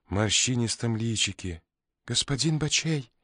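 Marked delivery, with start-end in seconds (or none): 2.1-3.1 high piercing exclamation; shrill bird voice calling out; piercing birdlike cry. A narrator's reading. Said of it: "воскликнула она пронзительным птичьим голосом".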